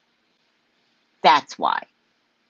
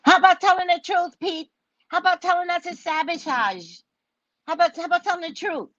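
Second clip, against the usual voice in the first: exasperated demand; 9 semitones higher, faster than usual. Acted. Demanding truth from Pete.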